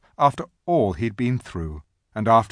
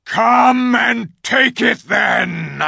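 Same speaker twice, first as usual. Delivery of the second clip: slow, full-force bellowing bull; great bellow; 11 dB louder, much slower than usual. A narrator's reading. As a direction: roar it out as a challenge. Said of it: "in a great bellowing voice".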